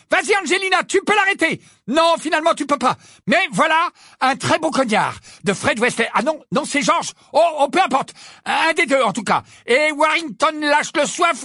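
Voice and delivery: high, excited, self-correcting sports call; cried-out commentary. A narrator's reading. Lee commentating and cheering Gryffindor on, excited. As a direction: chase the play, ending in an urgent shout. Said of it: "s'écria Lee".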